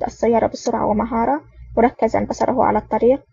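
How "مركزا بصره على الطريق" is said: plain reading voice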